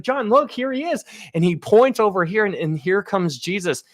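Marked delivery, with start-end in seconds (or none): 0.0-1.0 a sudden much higher pitch, eager and bright-eyed; elder breaking the news in a leap of pitch; excited pointing out. Retold or imitated. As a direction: announce it like a thrilled reveal. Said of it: "he's like".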